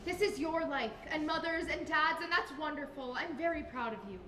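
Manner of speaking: gentle earnestness. Acted. Granddaughter gently explains her choice.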